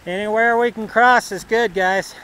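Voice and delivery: loud call across the creek. Real, not acted. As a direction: shout it over the water. Calling to friends across creek.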